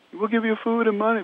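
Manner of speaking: high-pitched mock shout; retold yell. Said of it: "they yelled back".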